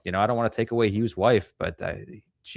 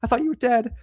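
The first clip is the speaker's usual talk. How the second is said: much higher, fake-happy put-on voice; mock wife. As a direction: gush it like a falsely delighted wife. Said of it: "she's like".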